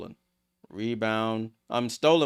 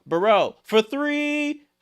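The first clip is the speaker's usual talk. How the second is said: much higher, slow and stretched on the shot; drawn-out excited call; 11 semitones higher, 4 dB louder, slower than usual. Real, not acted. Streamer calling a three-pointer in the air, stretched excited call. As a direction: hang on the words while the ball flies.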